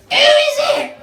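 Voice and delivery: very high, slow squawking parrot. Acted. Parrot squawking at the knocker. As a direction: screech it out like a parrot.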